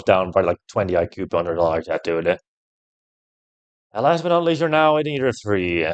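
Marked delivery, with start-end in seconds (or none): none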